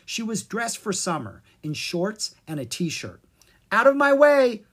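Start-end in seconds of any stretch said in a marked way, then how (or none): 3.7-4.6 high, loud, bossy shove-off; rude troll girl. Acted. Troll girl pushing past Kara and Zed, loud and rude. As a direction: bark them out of her path.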